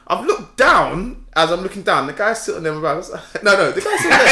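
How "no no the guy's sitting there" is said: high, loud, through real laughter; laughing too hard to tell it straight. Real, not acted. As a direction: insist on it while cracking up. Both laughing at the story.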